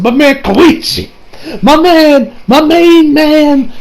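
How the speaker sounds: high, loud, stretched-out words; hyped cheering. Real, not acted. Fan greeting a favourite character, loud drawn-out cheer. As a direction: hail your favourite like a hype man.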